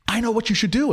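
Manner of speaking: high-pitched eureka moment; retold excitement. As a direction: imitate her excitedly announcing a revelation. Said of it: "She suddenly gets really excited".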